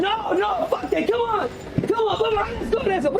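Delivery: retold hyped-up come-on; rallying call. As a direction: pitch way up, egging the crew on to fight. Crew rallying to fight.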